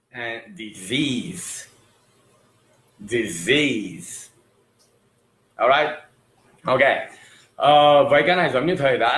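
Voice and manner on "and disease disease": disease said slowly, di-ZEEZ, stress on the end; drawn-out disease to repeat; slow model pronunciation. Shown as a demonstration. Teacher slowly modelling disease for students to repeat. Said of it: "Tập lại phát âm một chút với thầy nhá".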